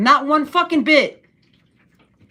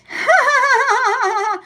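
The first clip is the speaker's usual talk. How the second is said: burst of laughter; 13 semitones higher, 3 dB louder than usual. Real, not acted. Cracking up over Kamala Harris.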